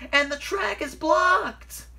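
high, slow, loud wail; shrieked, drawn-out alarm; alarmed cry. A narrator's reading. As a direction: cry out the bad news in panic. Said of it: "cried Percy".

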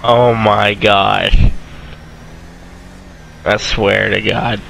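slow, low exasperated groan. Real, not acted. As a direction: drag it out in disbelief. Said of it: "Ooh, you cursed".

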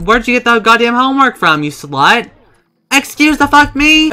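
loud, high outraged yelling; pissed off. Real, not acted. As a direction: explode in disbelief at the glitch. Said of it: "I'm so fucking pissed off right now".

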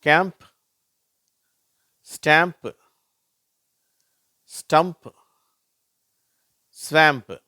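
"கேம்ப், ஸ்டாம்ப், ஸ்டம்ப், ஸ்வாம்ப்" high, loud, ending in mp; MP sound demo. Shown as a demonstration. Teacher reading MP examples, "கேம்ப், ஸ்டாம்ப், ஸ்டம்ப், ஸ்வாம்ப்" loud and separate. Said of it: "அது இம்ப்".

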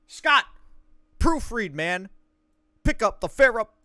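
high, loud hammed-up shouting; mock yelling. Reading the game tips out to Scott, loud mock yell. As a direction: bellow the lines like a drill sergeant.

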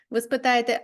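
normal talking voice

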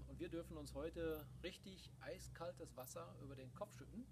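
ordinary talk

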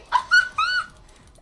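loud squealed laughter; real startled cackle, way up high; high shrieking laugh. Real, not acted. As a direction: burst out in very high-pitched laughs. Startled, laughing at enemy in game.

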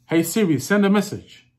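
English accent. Shown as a demonstration. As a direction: give a loud, clear command in an English accent. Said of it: "I'll do the English accent".

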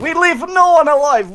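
high loud mock Russian soldier; Russian accent. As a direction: bark it like a Russian comrade. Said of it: "speak in a Russian accent".

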